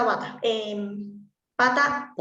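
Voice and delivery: slow, drawn-out bata, then pata; contrasting bata and pata. Shown as a demonstration. Therapist to class, slowly modelling bata and pata. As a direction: spell out the swap of B and P. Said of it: "y que nos diga pata".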